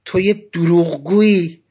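slow, loathing accusation; utter hatred. A narrator's reading. Cellmate accusing narrator of lying, hateful. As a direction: press out the accusation with disgust. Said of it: "با نفرت تمام گفت".

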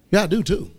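ordinary delivery

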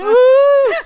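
high, loud whoop; long mock cheer; imitated crowd cheer. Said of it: "everyone started cheering".

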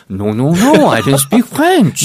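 slow mock French accent. Answering "do you speak French?", playful mock French accent. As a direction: deny it in a put-on French accent. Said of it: "you have a French accent".

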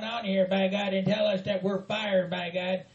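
ordinary delivery

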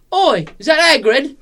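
put-on high, loud cheeky British kid shouting "Oi"; British kid accent. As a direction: yell it up at the bus like a cocky English kid. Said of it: "That was pretty good".

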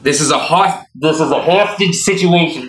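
usual speaking voice

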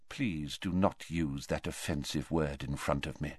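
a quiet, weighty reproach; quiet rebuke. A narrator's reading. Old headmaster rebuking the boy, low and quiet.

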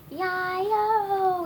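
high, loud yelp with a long tail; yelling. A narrator's reading. Grabbed funny little man hollers, yelling. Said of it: "yelled the funny little man".

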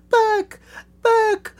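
very high, loud squeaky yelp; mock squawk. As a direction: squawk each word out high and short. Acting out her repeated cry.

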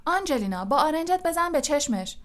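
high-pitched urging from a commentator; partisan egging-on. Acted. Lee eggs Angelina on against Flint, excited urging.

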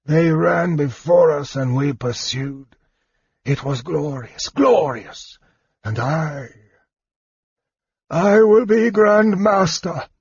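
wounded knight, feverish exultation; fevered dying knight. Acted. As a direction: boast of glory in delirium, swelling to the end.

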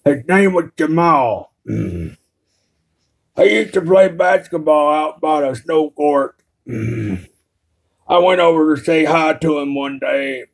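odd Carl-type character voice, deliberate and drawn out; slow, awkward storyteller character. Pretend. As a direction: tell it stiffly in a put-on drawl.

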